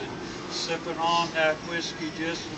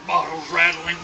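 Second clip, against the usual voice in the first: ordinary delivery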